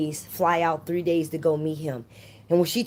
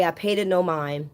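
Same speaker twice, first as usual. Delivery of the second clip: usual speaking voice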